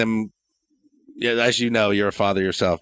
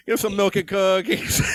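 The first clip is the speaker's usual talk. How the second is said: put-on higher, slow bossy toddler voice; small kid's high-pitched demand; two-year-old demanding; 10 semitones higher than usual. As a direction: play a toddler ordering you around. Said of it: "a two-year-old looking at me like this".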